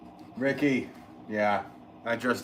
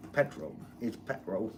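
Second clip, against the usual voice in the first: half-hearted Cockney British impression; Cockney British accent; 5 dB quieter than usual. Shown as a demonstration. Telling viewers how the British say it, light Cockney British accent. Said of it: "My British accent's more of a Cockney sound".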